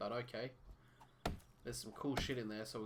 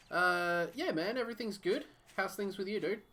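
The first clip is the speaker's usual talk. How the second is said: upbeat chatty; 8 semitones higher, 5 dB louder than usual. Real, not acted. Chatting to a viewer.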